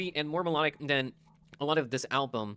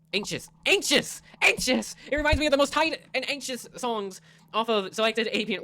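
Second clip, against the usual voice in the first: loud exaggerated; 8 semitones higher, 5 dB louder than usual. Loud mocking repetition.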